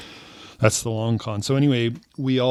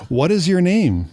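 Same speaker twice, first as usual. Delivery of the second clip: raised-pitch mock questioning; insistent stranger; 8 semitones higher, 3 dB louder than usual. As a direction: press the question in a put-on higher voice. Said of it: "she says".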